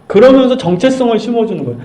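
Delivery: usual speaking voice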